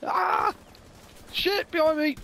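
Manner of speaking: sudden high yelp of alarm; startled shout. Real, not acted. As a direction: caught off guard, cry out. Startled in gunfight.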